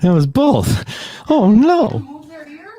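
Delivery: very high, loud squeal; mock shocked. Learning elephants move their ears, squeals in high mock shock.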